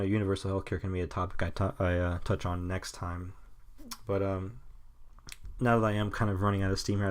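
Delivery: ordinary delivery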